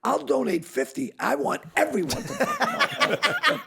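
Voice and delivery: laughing brag. Real, not acted. Self-mocking brag.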